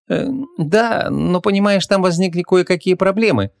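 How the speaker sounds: high, hesitant excuse; stall, feeling for an explanation; awkward hemming and hawing; faltering. A narrator's reading. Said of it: "замялась Ванесса".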